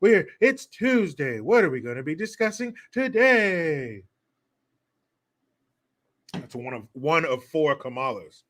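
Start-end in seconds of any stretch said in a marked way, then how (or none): 0.0-4.0 Kamala impression. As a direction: stretch it out with exaggerated swoops. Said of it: "in my best Kamala voice".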